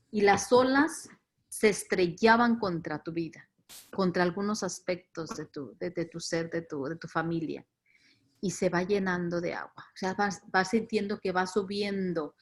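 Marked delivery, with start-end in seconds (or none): none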